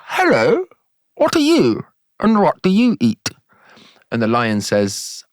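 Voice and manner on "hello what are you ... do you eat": high, swooping cartoon frog voice; wide mouth frog. Frog greets a giraffe in a joke, high swooping voice. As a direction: squeal it wide-mouthed, pitch leaping up.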